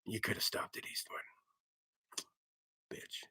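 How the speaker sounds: low, whispered tough-guy rasp; low whispered Eastwood impression; gravel tough-guy voice. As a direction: growl it like a movie hard man. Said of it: "Go to the doctor like".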